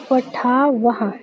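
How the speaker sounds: पठावह said very slowly, ending in a clear "ha"; पठावह, slow and clear with the visarga sounded as "ha". Shown as a demonstration. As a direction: drag out पठावह syllable by syllable, voicing the final "ha". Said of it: "इसको ह करके उच्चारित करते हैं".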